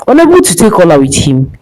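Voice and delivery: ordinary delivery, nothing marked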